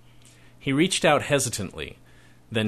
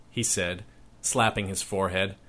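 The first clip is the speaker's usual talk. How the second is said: ordinary delivery, nothing marked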